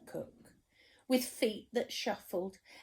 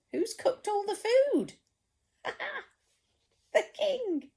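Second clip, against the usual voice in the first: excited question; 13 semitones higher, 3 dB louder than usual. Real, not acted. Storyteller quizzing the child, high and playful. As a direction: tease out the answer. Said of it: "asks listener".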